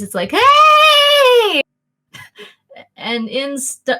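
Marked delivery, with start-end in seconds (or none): 0.3-1.6 long, high drawn-out holler; cheerful yell. Acted. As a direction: holler a loud greeting, not angry or shocked. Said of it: "it's not like a yell of anger or shock or surprise".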